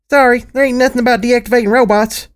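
put-on high small girl's voice; chirpy young filly reading off the screen; little filly voice. Little filly, a bit sad.

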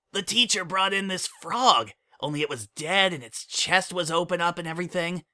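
put-on high, bouncy kid voice; young colt voice. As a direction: play an eager little colt. Child character voice.

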